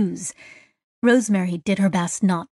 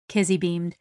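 ordinary delivery, nothing marked

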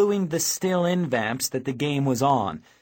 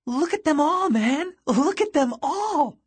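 much higher, breathless-style amazement; gasped exclamation; 13 semitones higher than usual. A narrator's reading. Ange doubled over at the huge crowd, gasping high. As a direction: gasp it out in a high voice. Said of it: "she gasped".

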